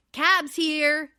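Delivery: loud call up the stairs, Kyle's catchphrase; yelling. Retold or imitated. Kyle calling out to the house, loud yell. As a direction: shout it out to the house. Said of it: "And Kyle yells".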